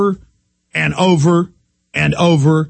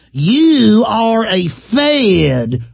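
slow, put-on sneering voice; mocking accuser; 4 semitones higher, much slower than usual. Host mimicking a militia accuser, slow mock voice. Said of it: "that person putting on that accent".

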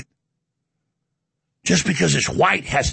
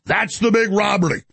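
ordinary talk, nothing marked

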